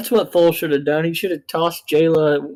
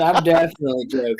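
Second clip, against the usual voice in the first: sarcastic; faster than usual. Retold or imitated. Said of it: "You sound very sarcastic".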